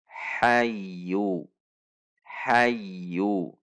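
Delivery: "Hayyu" gliding into a held y, without a jerk; smooth doubled y. Shown as a demonstration. Teacher models ya tasydid, glided without a jerk. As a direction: lean smoothly into the y of "hayyu", then release. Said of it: "Anda bisa melatihnya".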